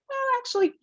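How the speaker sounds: jumps very high, playful pet voice; squeaky, hesitant dog voice; voicing the dog. Trainer voicing the dog asking for a break, very high. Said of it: "occasionally she's like".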